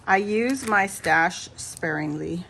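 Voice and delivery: normal talking voice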